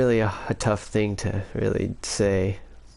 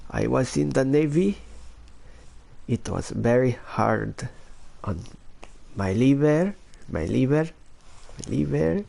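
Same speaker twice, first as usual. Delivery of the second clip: Filipino accent impression, higher, rising line ends; 5 semitones higher than usual. Shown as a demonstration. Recalling the Navy being hard on the liver, Filipino accent. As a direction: put on a Filipino accent with lilting rises. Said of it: "Here's my Filipino accent".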